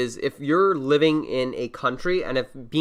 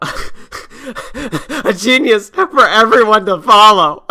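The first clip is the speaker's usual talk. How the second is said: laughing mockery; 7 semitones higher, 8 dB louder, much slower than usual. Real, not acted. Mocking intro of Tate.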